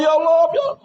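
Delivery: high, wailing mock cry to God; acted tearful "Ya Allah"; tearful plea. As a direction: act out weeping in prayer, pitched well up. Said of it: "kita nangis-nangis pada Allah".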